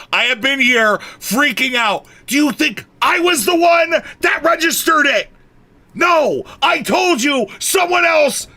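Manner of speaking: high, loud furious yelling at chat; real angry shouting. Real, not acted. Streamer yelling at chat in real anger. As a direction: blow up at them, voice cracking high. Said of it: "I'm so mad".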